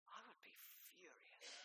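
breathy whisper, high and hushed. Pretend. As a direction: confide it under your breath. Preacher imagines his own reaction.

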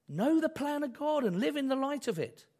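ordinary talk, nothing marked

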